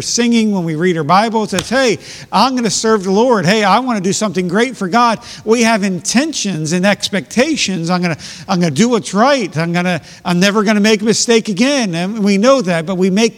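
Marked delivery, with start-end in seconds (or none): 1.7-5.2 higher, louder, bright-eyed vow; imitating a fired-up believer after good preaching, higher and louder; eager resolve. 7.9-11.9 higher, louder, bright-eyed vow; imitating a fired-up believer after good preaching, higher and louder; eager resolve. Believer's bold promises.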